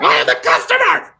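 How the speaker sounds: high-pitched, hammed-up protest; mock-indignant yell, far above normal pitch; indignant customer outburst. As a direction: comically shout it like an outraged customer. Acting his indignant outburst at staff.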